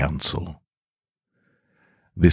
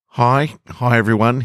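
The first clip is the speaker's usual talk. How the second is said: high, louder nervous hi; nervous greeting; 9 semitones higher, 4 dB louder than usual. A narrator's reading. Kyle greeting the council, nervous and high. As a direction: squeak out a nervous hello. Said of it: "He gave a nervous wave".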